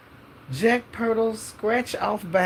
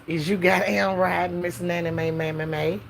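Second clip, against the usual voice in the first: ordinary talk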